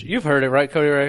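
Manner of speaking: ordinary delivery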